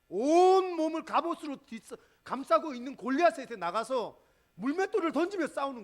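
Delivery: 크고 높게 몰아치는 설교; 절정으로 치닫듯 힘껏; forceful preaching. Acted. Climactic sermon telling. (회중을 향한 힘찬 설교)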